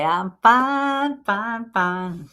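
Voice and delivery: put-on drawn-out country accent, falling away. Quoting [name] to a listener, country accent. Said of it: "that was my country accent coming out".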